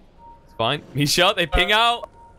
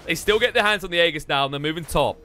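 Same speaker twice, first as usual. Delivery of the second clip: lively esports commentary; play-by-play; 4 semitones higher than usual. Acted. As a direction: call the fight as it unfolds. Casts live play-by-play.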